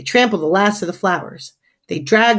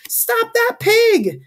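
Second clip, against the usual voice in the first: very high, drawn-out yell; shouted demand; much slower than usual. A narrator's reading. Neighbor demands the pig be stopped, shouted demand. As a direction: holler it slowly across the yard. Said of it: "shouts the next door neighbor".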